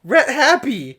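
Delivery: high, loud burst of silly joy; hammed-up happy cheer; mock elation. A narrator's reading. Reader acts the story's happy ending, mock-elated.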